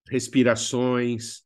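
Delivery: ordinary talk, nothing marked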